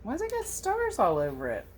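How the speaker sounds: ordinary talk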